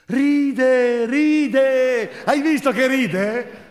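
excited delight. Acted. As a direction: burst out with joy at the baby's first laugh. Parent sees the baby laugh.

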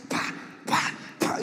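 short voiceless popped syllables; imitated punches. Comedian showing hits to his ear, imitated punches. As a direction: imitate blows landing with your mouth. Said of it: "Me golpeaba directamente en la oreja, así".

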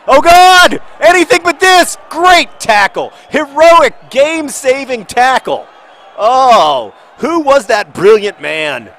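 very high, shouted match commentary; frantic commentary. Real, not acted. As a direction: scream in panic, then hail the heroic tackle. Commentates a last-ditch tackle.

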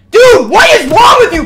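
loud, high-pitched yell; exasperated shout. Real, not acted. Streamer scolding Popcat for his antics, exasperated shout.

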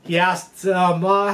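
ordinary delivery

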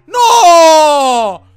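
loud, high cry of no. Real, not acted. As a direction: wail out the no. Losing armour in game.

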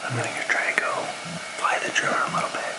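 hushed whisper so nobody wakes. Real, not acted. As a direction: whisper it quietly in the early morning. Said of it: "I don't wanna wake anyone".

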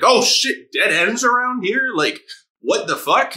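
high, rising mock alarm; mock panic. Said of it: "He was like".

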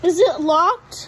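loud, slow call to another room; yelling across the room. Real, not acted. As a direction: call it out so dad hears. Calling to dad from afar.

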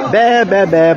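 high mock bleating like a goat; playful goat imitation.

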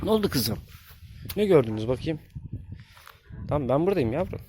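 high, gentle cooing voice; talking to goslings. Real, not acted. Reassuring frightened goslings.

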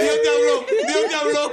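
voz alta y fuerte, casi gritando; exclamación eufórica. Real, not acted. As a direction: grita emocionado que Dios habló. (amigo exclama eufórico: Dios te habló)

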